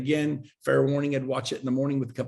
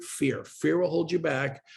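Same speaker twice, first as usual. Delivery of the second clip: ordinary talk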